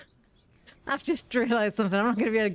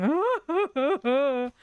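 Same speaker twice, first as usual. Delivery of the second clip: high squawking chick cries imitated; mock chick screams; 6 semitones higher, slower than usual. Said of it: "sit in the back of the car going".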